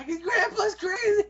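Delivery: put-on slow, very high child wail; loud mock-tearful kiddie voice; little grandkids. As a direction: wail it like a terrified kid. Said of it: "my grandkids when they're little".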